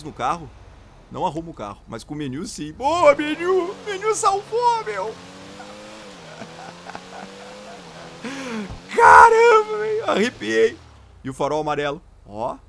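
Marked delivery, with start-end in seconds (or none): 2.7-5.0 high, loud, drawn-out cheer; elated cheer. Real, not acted. Gamer cheering the menu that saved the car, high drawn-out shout. 5.1-8.9 long wheezing-out laugh; laughter. Real, not acted. Gamer laughing after getting his car back, long laugh. 8.9-10.8 high, loud excited outburst; thrilled shout. Real, not acted. Gamer amazed at the rescued car, high thrilled shout.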